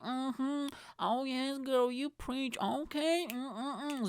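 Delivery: high-pitched mock female listener; hammed-up high "preach, girl" voice; sassy approving woman. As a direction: cheer her on in a put-on high, sassy voice. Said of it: "if there's any women ever listening".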